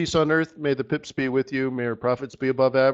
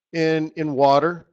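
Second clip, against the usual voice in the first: normal talking voice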